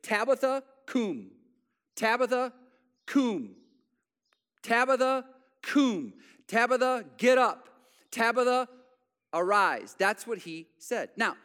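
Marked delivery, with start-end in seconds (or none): 0.0-10.0 raised voice calling her name, louder and higher; calling out to the dead. Acted. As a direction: call her back to life, urgent. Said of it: "in that language was".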